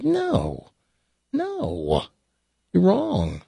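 lightly voiced daughter brushing dad off; denying daughter. Daughter denies it in joke.

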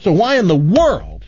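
hammed-up offense, building pitch; offended indignation. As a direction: rant like an offended customer. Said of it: "the clerk is offended by that".

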